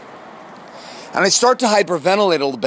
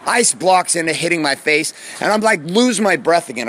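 ordinary talk

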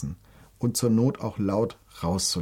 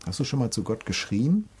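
ordinary talk, nothing marked

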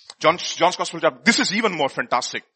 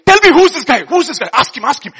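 high, loud, insistent questioning; acted eager demand to know; urgent prompting; 6 semitones higher, 8 dB louder than usual. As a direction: nag for the answer in rapid repeats. Simon urgently prompts disciple to ask.

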